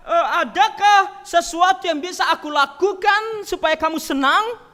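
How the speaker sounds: slow pleading mock tone; mock coaxing. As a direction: sweet-talk like a flatterer. Mock pleading Jesus never said.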